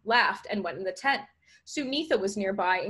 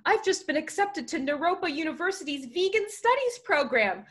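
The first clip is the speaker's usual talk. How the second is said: high, bubbling delivery; gleeful, raised-pitch reveal; excited announcement; 8 semitones higher than usual. Acted. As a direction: share thrilling news with friends. Ethan shares his acceptance.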